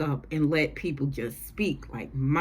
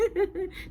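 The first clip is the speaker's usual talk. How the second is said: high-pitched imitated giggle; mock giggling; 4 dB quieter than usual. New couple giggling in each other's faces, mock giggle. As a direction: giggle like a lovesick pair. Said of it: "they giggling all in each other's faces".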